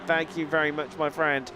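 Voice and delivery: put-on a light, casual generic American accent; a hint of American accent with a head cold. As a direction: toss the line off in a passing American accent. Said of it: "My best generic American accent there".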